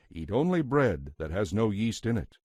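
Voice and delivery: usual speaking voice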